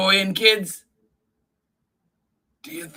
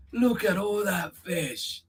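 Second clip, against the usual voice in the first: ordinary delivery, nothing marked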